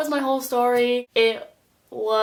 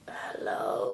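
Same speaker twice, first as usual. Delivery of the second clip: barely voiced, breathy little hello; whispered hello; 9 dB quieter than usual. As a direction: friend in a hospital bed whispers hello to her. Sick friend greets her weakly.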